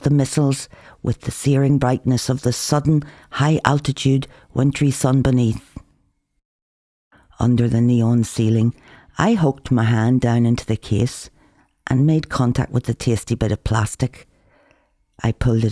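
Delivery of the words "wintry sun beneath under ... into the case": ordinary delivery, nothing marked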